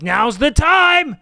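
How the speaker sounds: high, slow and shouted like a reckless deer. Retold or imitated. Comedian voicing a deer bolting into traffic, loud slow yell. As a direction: belt it out as a suicidal deer. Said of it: "they're like".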